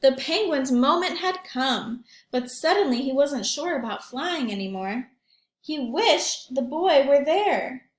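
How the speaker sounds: put-on lively read-aloud for young children; story narration. A narrator's reading.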